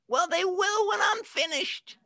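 put-on very high child's voice; high-pitched little kid voice, pleased with himself; little boy voice. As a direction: squeak it out like a small boy. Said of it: "that's my best little boy voice".

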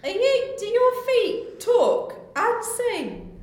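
high, loud startled character; startled shout. Acted. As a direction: shout up in alarm.

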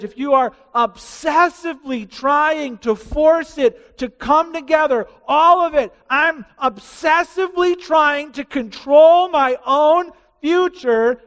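high, loud and slow, straining; preacher shouting about obsession; impassioned preaching. Acted. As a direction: drag out the words with urgency. Preaching climax on control.

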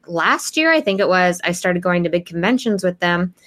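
ordinary talk

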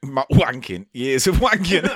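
ordinary talk, nothing marked

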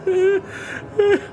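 high, soft laugh breaking toward tears; half-sobbing laugh. A narrator's reading. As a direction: laugh that nearly cries. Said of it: "a low laugh".

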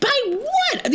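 very high, outraged squeal; hammed-up disbelief; incredulous. Friend retelling being called triggered, high incredulous.

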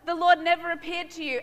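high, loud anxious protest; protesting Moses. Acted. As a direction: plead your excuses to God. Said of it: "Moses protested again".

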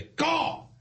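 high, abrupt yelled command; impatient dad yelling. Retold or imitated. Dad gives the car a command, high mock yell. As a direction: imitate an impatient dad hollering. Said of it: "he's yelling before the beep".

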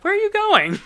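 loud, very high outburst; mock alarmed call. Dad calling after his fleeing daughter, mock alarmed.

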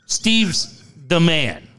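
slow, spaced out STEVE'S, then THE MAN; slow careful correction. Shown as a demonstration. Host correcting a garbled line for co-host, slow and deliberate. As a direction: spell it out slowly so nobody mishears. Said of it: "Sorry, I said that so fast".

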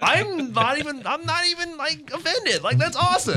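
very high, gushing disbelief; delighted reassurance. Retold or imitated. Cosplayer telling girls he isn't offended, thrilled high-pitched reply. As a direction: squeal it, thrilled at the mix-up.

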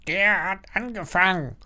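high parrot voice, subdued; small, high parrot protest; meek parrot. A narrator's reading. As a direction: croak a sheepish excuse. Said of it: "sagt der Papagei kleinlaut".

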